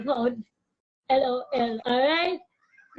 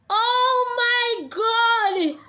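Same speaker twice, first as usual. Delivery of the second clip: surprised exclamation; 7 semitones higher, 6 dB louder, much slower than usual. Real, not acted. Reacting on the live stream.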